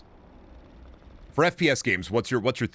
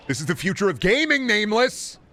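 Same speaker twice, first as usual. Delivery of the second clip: excited shout; 10 semitones higher than usual. Real, not acted. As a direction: sell it like a hype announcer. Repeats line, now shouting.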